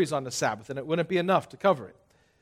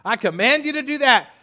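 bossy mock command voice, raised; preacher mocks giving orders to the congregation, raised and bossy; 7 semitones higher, 4 dB louder than usual. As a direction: play a pushy boss giving orders. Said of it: "If I was to stand up here and say".

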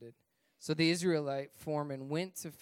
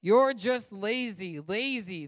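loud, slow, scornful shout; 4 semitones higher, 7 dB louder, slower than usual. Acted. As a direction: bellow the accusation down at them. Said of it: "But Pharaoh shouted".

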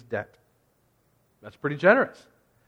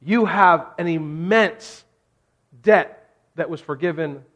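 louder and higher, urgent sermon build; preaching at full force; 6 semitones higher, 5 dB louder than usual. Real, not acted. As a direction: push the message to the back row. Preaching climax, loud and high.

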